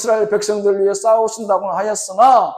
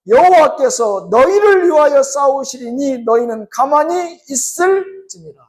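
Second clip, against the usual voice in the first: raised, measured reading of the verse; scripture reading; 4 semitones higher, 4 dB louder than usual. Acted. Preacher reading Exodus to the congregation, measured and raised. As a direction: read the Bible verse out to the congregation. Pastor reads scripture aloud.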